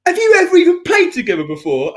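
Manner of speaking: high, incredulous imitation of the groom; mock outraged questioning. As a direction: demand it like an appalled client. Groom interrogating, retold.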